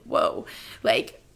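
breathy awed whisper; whispered amazement. Retold or imitated. Vlogger recalling first meeting her boyfriend, awed whisper. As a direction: whisper it, stunned by him. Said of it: "And I was like".

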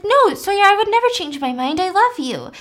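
high-pitched, earnest retold plea; acted protest. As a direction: play an insistent lovesick protest. Lovesick man protests in retold scene.

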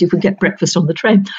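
ordinary talk

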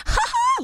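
very high squeaky gasp; tiny high-pitched thrilled squeals; excited squeaking. Retold or imitated. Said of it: "literally just squeaking".

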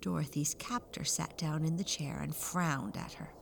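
ordinary delivery

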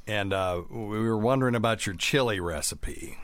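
ordinary talk, nothing marked

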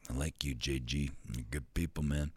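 warm Gruden imitation. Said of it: "Everybody in the room was doing it".

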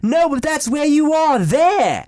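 high, loud, slow goofy spy voice; slow mock-suave nonsense line; Austin Powers impression. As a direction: drag it out smugly like Austin Powers. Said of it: "And Austin Powers is like".

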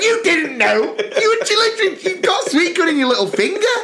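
high laughing indignation; laughing protest. Retold or imitated. Scolding the server over sweetcorn, laughing high.

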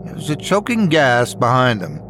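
plain reading voice

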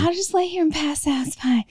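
breathy whispered resignation; whispering. Retold or imitated. Mocking her own meek self.

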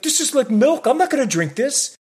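high-pitched complaining customer, easing down at the end; real Karen. Retold or imitated. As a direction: protest like an outraged Karen. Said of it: "I was real Karen".

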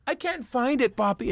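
high, pleading-style put-on woman's lines; imitating scene partner. Actress calling back to her partner from the trunk, imitated high voice. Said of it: "and they show her".

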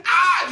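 high startled yelp; sudden scream. Pretend. Startled driver shrieking at sudden scare. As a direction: shriek out of nowhere. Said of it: "You scream like a bitch".

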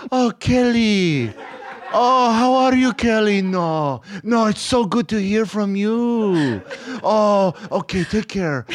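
high, drawn-out gushing impression; fawning niceness. Dad sucking up to Kelly on the phone, gushing. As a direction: imitate a gruff dad turned sugary sweet. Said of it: "the only person he was nice to".

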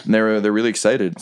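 usual speaking voice

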